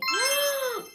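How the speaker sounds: high theatrical gasp; surprised gasp. Pretend. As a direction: gasp as if someone just appeared. Owl appears.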